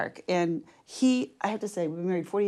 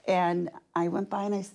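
usual speaking voice

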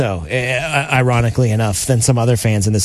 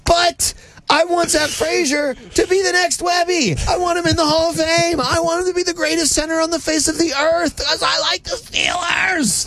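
excited shout. Real, not acted. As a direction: belt it like a fired-up fan. Fan rant, shouting.

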